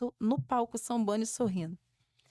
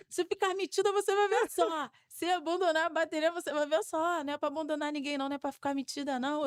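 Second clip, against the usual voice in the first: higher, teasing threat; mock warning; 6 semitones higher than usual. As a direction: wag a finger playfully at her. Meninos da bateria advertindo brincando. (ameaça de brincadeira, mais aguda)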